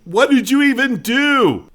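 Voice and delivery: very high, loud yell; baffled yell from down below; heckler's shout. Acted. Crowd member cannot see the illusion, high baffled shout.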